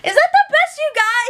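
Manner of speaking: mock disbelief. Real, not acted. Vlogger mocking Whitney's excuse, incredulous scoff. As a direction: scoff like it's ridiculous.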